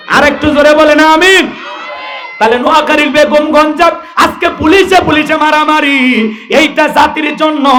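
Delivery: high, impassioned sermon delivery; full-force preaching. Acted. Preacher tells crowd of police brawling, impassioned and high.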